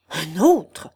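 loud, outraged exclamation; trembling with rage. A narrator's reading. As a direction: burst out in angry disbelief. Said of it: "s'écria Guillaume en frémissant de rage".

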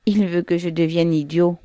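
plain reading voice

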